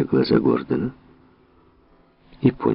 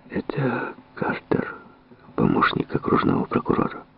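whispering; 7 semitones higher, 5 dB quieter than usual. Acted. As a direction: lean in and whisper it. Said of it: "прошептал Харрис Гордон".

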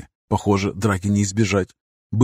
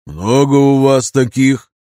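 wise elder; 4 semitones higher, 6 dB louder, slower than usual. Acted. Wise old elder asks.

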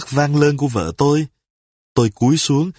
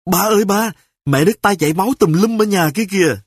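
son shouting; 7 semitones higher, faster than usual. Acted. A little son runs crying out to his father that mom is bleeding. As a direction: blurt the news out in a rush. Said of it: "miệng la bài bãi".

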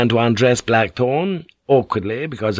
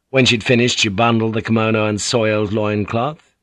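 ordinary talk, nothing marked

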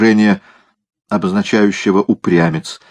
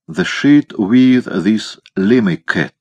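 American English; 2 semitones higher, much slower than usual. Acted. American soldier speaks English.

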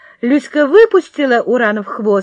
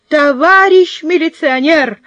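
louder first call; 6 semitones higher, 4 dB louder than usual. Acted. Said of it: "затараторила".

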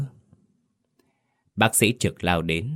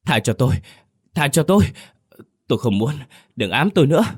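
man in a hospital bed screaming at a ghost; terrified screaming; 7 semitones higher, faster than usual. A narrator's reading. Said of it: "miệng la thất thanh".

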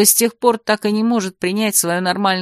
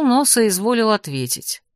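usual speaking voice

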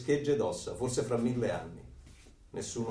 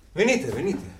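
loud, higher call to come in; raised welcoming call; 8 semitones higher, 5 dB louder than usual. Acted. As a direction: beckon them loudly across the room. Moreno calls the visitors in.

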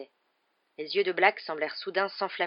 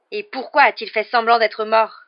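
loud, raised, angry challenge; furious cry; 4 semitones higher, 11 dB louder than usual. A narrator's reading. Harry confronts the adults about the faked death, furious cry. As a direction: hurl the question in fury. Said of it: "s'écria-t-il avec fureur".

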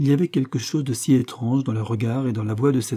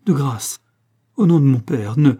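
raised, louder plea, cut off; urgent pleading; 3 semitones higher, 8 dB louder than usual. A narrator's reading. Andrea between the two men, pleading urgently. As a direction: beg them to stop, building in force.

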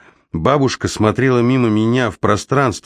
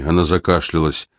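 plain reading voice

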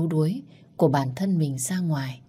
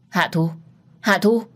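alarmed call; 3 semitones higher, 4 dB louder than usual. A narrator's reading. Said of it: "Anh hoảng hốt gọi cô".

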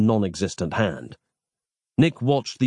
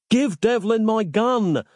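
yelled; 12 semitones higher, slower than usual. A narrator's reading. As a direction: shout the order, drawn out. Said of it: "yelled Nick".

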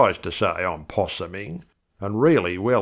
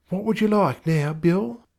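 higher, gentle wife voice; a doting wife, higher; wife's voice; 10 semitones higher than usual. A narrator's reading. Wife fussing over husband Bill, higher.

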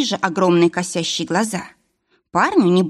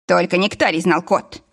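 ordinary delivery, nothing marked

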